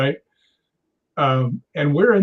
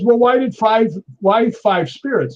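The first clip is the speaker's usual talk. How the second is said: higher, louder imitation of baffled questioning; mock incredulous; 7 semitones higher, 4 dB louder than usual. Docent grilling the spirit box, mock incredulous. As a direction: press the question, playfully astonished.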